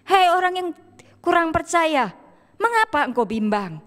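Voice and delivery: high, loud call easing into a falling question; rebuking Lord. Acted. The Lord to doubting Petrus, acted loud rebuke. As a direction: call out in reproof, then settle. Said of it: "Kemudian apa kata Tuhan?".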